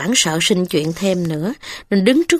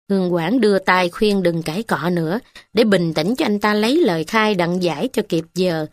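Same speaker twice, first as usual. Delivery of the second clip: plain reading voice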